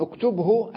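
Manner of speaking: اكتبه as uktub-hu; full vowel ending. Shown as a demonstration. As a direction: still ب, voiced hu at the end of اكتبه. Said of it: "الهاء مضمومة".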